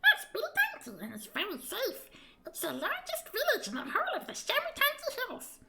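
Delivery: a proud little guide pointing out the village; tiny creature. Acted. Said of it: "Just listen to your voice".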